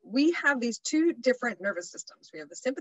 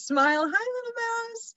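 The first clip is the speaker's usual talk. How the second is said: cutesy greeting; 13 semitones higher than usual. Retold or imitated. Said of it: "Emily says".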